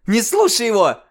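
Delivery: очень высоко и громко; взвизгни в страхе; визг. Acted. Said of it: "взвизгнула Арина". (испуганный визг)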